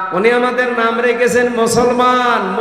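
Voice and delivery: usual speaking voice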